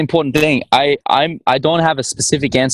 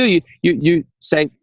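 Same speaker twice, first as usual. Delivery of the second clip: normal talking voice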